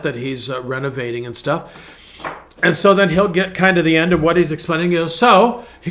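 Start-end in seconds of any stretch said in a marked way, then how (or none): 5.2-5.6 higher, louder British imitation; mock British accent. Pastor imitating a renovator wrapping up, mock British accent. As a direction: put on a playful British accent. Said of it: "So there it goes again".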